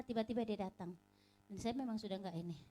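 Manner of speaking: normal talking voice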